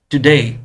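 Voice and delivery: "Today" said loud and alone, then a pause; word set apart. Shown as a demonstration. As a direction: land on "today" and stop. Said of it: "वहां पर gap आएगा".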